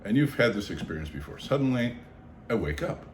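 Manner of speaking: ordinary talk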